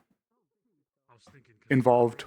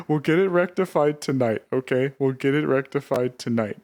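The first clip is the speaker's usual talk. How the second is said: ordinary delivery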